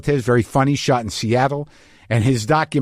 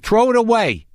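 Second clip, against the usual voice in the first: put-on Queens accent; 8 semitones higher than usual. Comic repeats his own line about the cake, Queens accent. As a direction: pitch it up like a Queens kvetcher. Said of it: "from Queens".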